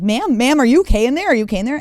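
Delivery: high, loud call through a door; urgent flight attendant. Retold or imitated. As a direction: imitate an insistent attendant checking on someone. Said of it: "starts banging on the door and she's like".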